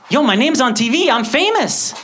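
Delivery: put-on high, loud boast of a thrilled kid; excited. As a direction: announce it like a kid who just got famous. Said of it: "I was excited".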